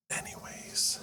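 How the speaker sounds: soft breathy whisper. Real, not acted. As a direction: drop to a whisper. Aside after impression.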